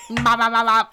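loud, laughing babble of syllables; Michael Scott. Retold or imitated. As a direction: trip over the syllables for comedy. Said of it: "but he's like".

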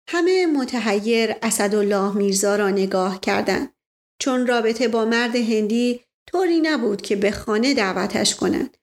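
usual speaking voice

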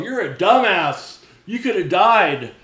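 loud, slow retold dressing-down; scolding. Friend scolds him after the rescue, loud reprimand. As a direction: chew him out, drawn out and high.

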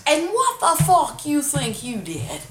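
high, slow, loud taunt; joking mock outrage. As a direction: drag out a hammed-up challenge. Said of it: "I'm jokingly for real saying the shit".

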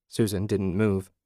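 ordinary delivery

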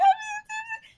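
very high mock wailing sobs; imitating high-pitched cries. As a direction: squeal out sobs like a frantic friend. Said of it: "high-pitched cries".

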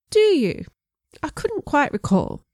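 put-on innocent English accent, jumping high on "Do you?"; a lightly voiced English gent feigning surprise. A narrator's reading. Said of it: "strong English accent".

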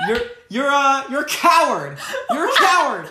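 high, loud, outraged impression; Rosie O'Donnell. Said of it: "Rosie O'Donnell".

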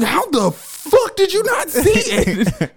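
high, slow, exasperated demand; furious they missed it, drawn out; exasperated disbelief. Retold or imitated. To her friends after leaving, high slow exasperation. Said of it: "I was like".

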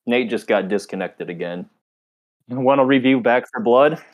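usual speaking voice

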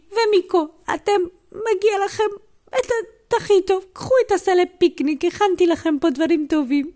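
high-pitched, gushing speech; emotional grandma. Acted. Grandma rewarding the children with a picnic basket, emotional.

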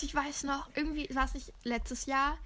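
usual speaking voice

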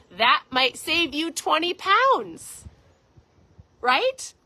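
slow, high and loud teasing punchline; playful emphasis. Real, not acted. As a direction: lean into the joke with a grin. Punchline to the viewers.

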